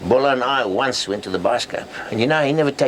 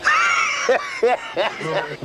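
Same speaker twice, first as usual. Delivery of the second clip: big laugh; 14 semitones higher than usual. Acted. Laughing at his own story.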